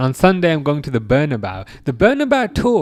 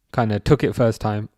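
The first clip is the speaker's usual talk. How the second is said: usual speaking voice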